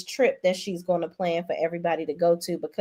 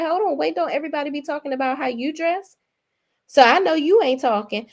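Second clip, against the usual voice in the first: higher-pitched mock sassy comeback; retold clapback; 7 semitones higher, faster than usual. Castmate challenging her critic about dress sense, retold as a high clapback. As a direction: imitate her clapping back, pitched up. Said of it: "I love how Tia clapped back".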